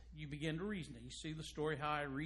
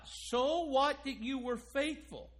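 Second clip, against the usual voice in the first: preaching rebuke; 5 semitones higher, 4 dB louder than usual. Acted. Preacher rebukes faithful without expression.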